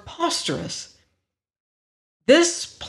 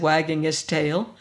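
plain reading voice

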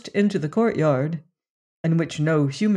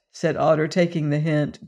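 ordinary delivery, nothing marked

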